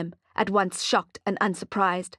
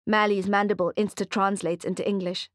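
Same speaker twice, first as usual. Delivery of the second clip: ordinary delivery, nothing marked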